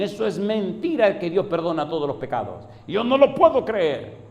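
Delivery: high, loud outraged protest, building; imitating an enraged unbeliever shouting; furious disbelief. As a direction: climb from loud objection to strained high yell. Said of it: "furiosos, me dicen".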